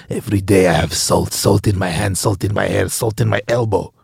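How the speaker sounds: low, soft put-on chef voice; salt-weary chef. Chef at home sick of salt, low weary voice.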